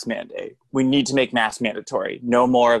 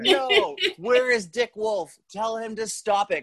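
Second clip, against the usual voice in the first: higher, slow mock protest; mock outrage; 6 semitones higher, slower than usual. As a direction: play at being scandalised. Mock outrage about Law and Order.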